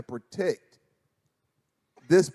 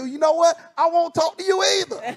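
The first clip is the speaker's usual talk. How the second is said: high, petulant comeback; husband sulking back at his wife, high mock pout; sulky retort; 12 semitones higher than usual. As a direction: snap back like a sulking kid, pitched way up. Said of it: "I said".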